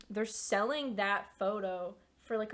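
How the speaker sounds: ordinary delivery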